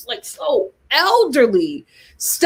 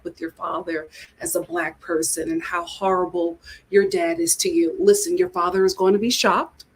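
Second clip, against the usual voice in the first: lightly voiced producer, lower than usual; coaxing producer; 7 semitones lower than usual. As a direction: lightly play a producer talking someone into it. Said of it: "that producer when they said".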